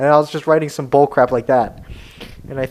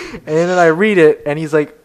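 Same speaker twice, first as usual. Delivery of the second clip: ordinary delivery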